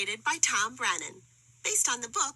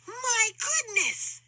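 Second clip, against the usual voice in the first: slow, higher amazed exclamation; mild surprise; 10 semitones higher, 3 dB louder, slower than usual. A narrator's reading. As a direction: marvel at the tiny thing. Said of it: "said Horton".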